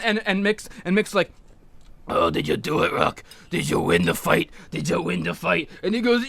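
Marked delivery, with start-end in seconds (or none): 2.1-5.7 put-on much lower, weak voice of a dying old man; dying Mickey. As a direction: rasp it out with fading strength. Dying trainer asks about fight.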